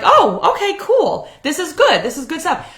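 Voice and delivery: high, bright mock delight at his cleaning; playing her hopeful past self, high and eager. Retelling her own hopeful reaction.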